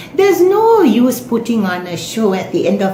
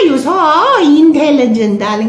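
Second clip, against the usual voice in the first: sarcastic praise; 6 semitones higher than usual. Acted. Sarcastic praise of the fake accent.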